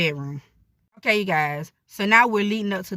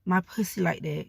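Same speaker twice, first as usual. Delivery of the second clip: moaning while talking. Shown as a demonstration. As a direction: let the lines trail into moans. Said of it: "don't be afraid to moan".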